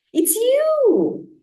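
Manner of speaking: high, loud cry of surprise; excited hoot. A narrator's reading. Owl spotting the lost bird, a high excited cry. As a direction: burst out in recognition. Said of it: "Otis hooted".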